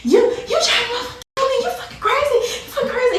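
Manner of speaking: high, loud yelling with a laugh; shocked yelling. Retold or imitated. Woman yelling at her attacker, high, loud, half laughing. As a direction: scream it in disbelief, laughing. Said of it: "I'm like".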